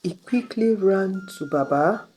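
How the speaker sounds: usual speaking voice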